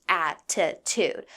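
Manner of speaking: ATTITUDE stretched out slowly, syllable by syllable; drawn-out emphasis. Real, not acted. Storyteller stressing how much attitude she got, ATTITUDE slowly drawn out. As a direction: draw out every syllable of attitude.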